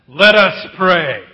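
slow, loud and solemn call to prayer; solemn prayer opening. Retold or imitated. Pastor calling the mourners to prayer, slow and loud. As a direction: draw it out like a pulpit summons.

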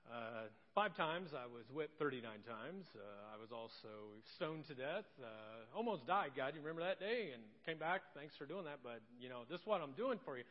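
low, quiet, offhand telling of hardships; Paul listing his suffering. Retold or imitated. Paul telling God what he endured, low wry understatement. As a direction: play it down like a weary, wry apostle. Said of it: "Paul comes up to me and says".

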